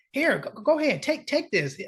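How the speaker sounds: imitating the mob guy pushing food, pitched well up; lightly hammed insistent host; high, coaxing offer. Mob patient urging nurse to take it, high coaxing.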